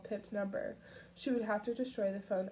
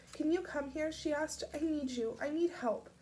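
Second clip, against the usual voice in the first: pleading; 7 semitones higher than usual. A narrator's reading. Pip pleading.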